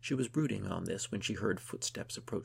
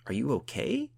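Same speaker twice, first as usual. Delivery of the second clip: concerned question; 5 semitones higher, 6 dB louder than usual. A narrator's reading. Asks her shaking friend.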